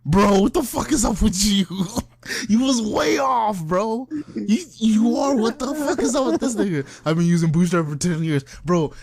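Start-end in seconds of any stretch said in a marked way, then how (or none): 0.1-6.8 high-pitched baffled reproach; incredulous. Acted. Storyteller calling out the lying designer, incredulous. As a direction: drag it out in amused disbelief. Mock outrage at the false boast.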